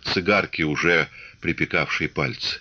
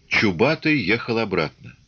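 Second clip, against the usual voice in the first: usual speaking voice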